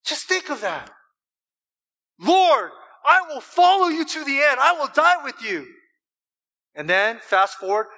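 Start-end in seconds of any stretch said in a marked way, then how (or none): 2.2-5.7 loud, high, impassioned pledge; ringing vow of devotion; Peter vowing loyalty. Acted. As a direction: declare it fervently at raised pitch. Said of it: "this is the same Peter who says".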